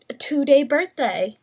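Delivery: ordinary delivery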